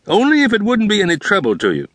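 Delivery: eager father asking a favour; Gorfinkel's voice. A narrator's reading. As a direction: rush the polite disclaimer. Gorfinkel's polite hedge.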